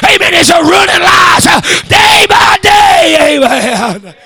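shouted preacher's whoop, punching each line; preaching at full force. Acted. Preacher roaring at the congregation about the mule. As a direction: drive the sermon home, chanting the climax. Whooping sermon on fast mules.

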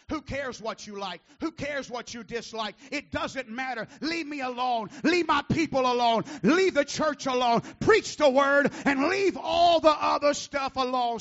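building from loud rebuke to full-force roar; full-throated pulpit climax; preaching shout. Real, not acted.

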